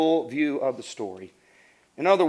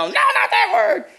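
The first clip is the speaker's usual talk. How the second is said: high, louder scandalized gasp of a mother; shocked mom; 6 dB louder than usual. Retold or imitated. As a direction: sound horrified at the bad word.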